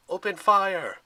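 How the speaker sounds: loud, high battle order; commanding yell. A narrator's reading. Zoe ordering the hunters to shoot, loud commanding yell. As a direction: bark the order to your archers. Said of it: "Zoe yelled".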